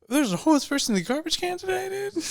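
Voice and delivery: high, fast exclamation; incredulous. Real, not acted. Man complaining of his neighbourhood to friends, incredulous. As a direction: exclaim it in rising disbelief.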